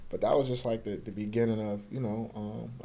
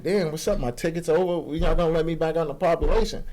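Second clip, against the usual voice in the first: louder and higher, fed up; raised frustrated voice; 6 semitones higher, 7 dB louder than usual. Retold or imitated. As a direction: complain loudly to the guard. Venting to the guard.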